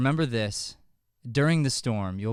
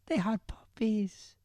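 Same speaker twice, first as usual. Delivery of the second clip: slow whispered reveal; teacher whispering the secret to the boys; 11 semitones higher, 4 dB quieter, slower than usual. Acted.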